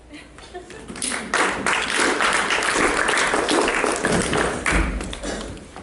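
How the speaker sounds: airy laugh rising up; breathy laugh. Real, not acted. Laughing at the end of her own sketch.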